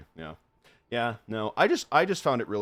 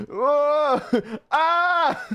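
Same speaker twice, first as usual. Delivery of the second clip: mock exclamation; 9 dB louder than usual.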